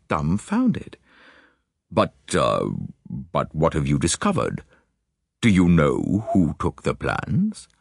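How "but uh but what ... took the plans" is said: Lord Alloway. Acted. Lord Alloway's dialogue in narrated story.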